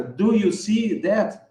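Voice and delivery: slow, every word of "Do you see that?" separate; textbook-correct, word by word; careful full question. Shown as a demonstration. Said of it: "você falava assim, correta". (pergunta completa, devagar e correta)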